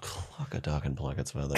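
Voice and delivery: very soft, low, mock-stunned; hushed dismay. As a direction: murmur it low as if news just sank in. Dismayed reaction in acted scene.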